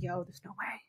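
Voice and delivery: breathless disbelieving whisper; stunned whisper. Retold or imitated. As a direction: whisper it like you can't believe it. Reliving the referee going down.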